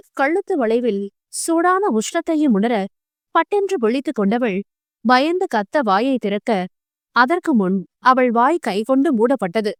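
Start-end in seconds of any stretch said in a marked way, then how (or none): none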